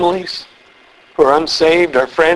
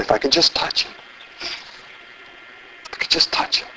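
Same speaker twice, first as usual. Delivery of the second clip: hushed, dropping to a whisper; saying to herself; 4 semitones lower, 10 dB quieter than usual. Retold or imitated. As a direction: murmur it privately, fading to breath. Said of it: "she was saying to herself".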